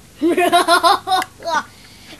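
loud theatrical villain cackle; evil laugh. Acted. Villain's laugh after excellent.

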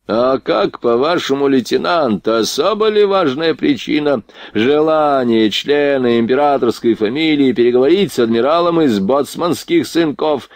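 high and affronted, talking down; imperious drunk prince. Acted. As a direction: pull rank on a lieutenant with haughty questions. Voices the irascible drunk prince.

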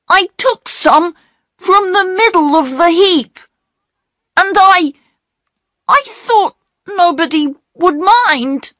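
put-on very high, upset child's excuses; pleading young boy. Peter explaining the coal to the station master, pleading young boy. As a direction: plead your case in a high boy's voice.

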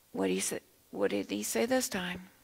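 ordinary delivery

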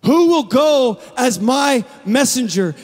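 God calling from His throne, slow and booming. Acted. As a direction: call out slowly as God seeking a messenger. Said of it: "It's a booming voice".

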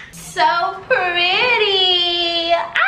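high, loud gushing squeal; delighted squeal. Real, not acted. Vlogger admiring flowers in the vase, delighted squeal.

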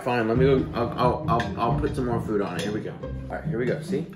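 ordinary talk

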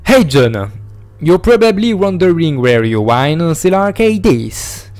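reading the note in a lightly put-on English accent. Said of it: "il est bon mon accent". (lecture avec un léger accent anglais)

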